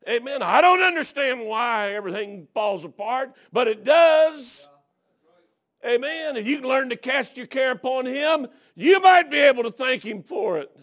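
loud, high preacher's cry building to a shout; full-force preaching. Real, not acted. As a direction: drive it home to the congregation at high pitch.

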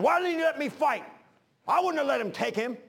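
mad Peter. Retold or imitated. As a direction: demand to know why he couldn't fight. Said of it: "He was mad".

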